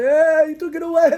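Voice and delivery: high-pitched protesting cry; mock complaint. Man complaining to Hashem after giving up reins, protesting.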